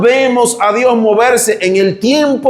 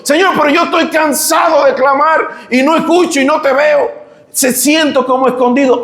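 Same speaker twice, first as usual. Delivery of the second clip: desperate cry; 7 semitones higher than usual. Acted. Habacuc's weary cry to God.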